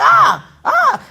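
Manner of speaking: high, loud "ah" yelps; hammed-up high cries; mock yelping. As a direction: imitate frantic hollering in two short bursts. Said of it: "Me and Debbie like".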